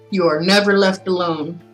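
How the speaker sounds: ordinary talk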